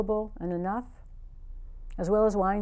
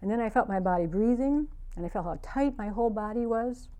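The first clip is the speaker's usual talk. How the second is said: usual speaking voice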